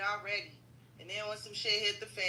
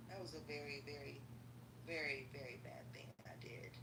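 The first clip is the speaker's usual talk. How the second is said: hushed guilty admission; soft, sheepish confession; whisper; 6 semitones lower, 15 dB quieter than usual. Real, not acted. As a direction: drop to a whisper on it. Admitting a bad deed.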